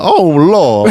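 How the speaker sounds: high shocked Southern lady gasp; old Southern lady. Retold or imitated. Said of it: "just an old Southern lady listening".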